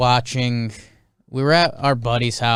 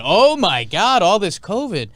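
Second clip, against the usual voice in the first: mock panic; 7 semitones higher, 3 dB louder than usual. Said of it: "the owners were gonna do, like, an".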